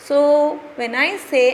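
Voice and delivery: usual speaking voice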